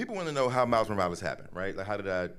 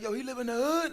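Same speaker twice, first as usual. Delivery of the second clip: much higher, eager question; excited kids; 4 dB louder than usual. Retold or imitated. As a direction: fire it off like a thrilled schoolboy.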